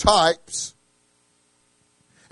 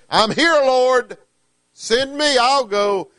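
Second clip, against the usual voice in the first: eager answer; 8 semitones higher, 4 dB louder, slower than usual. Retold or imitated. Eager answer to God's call.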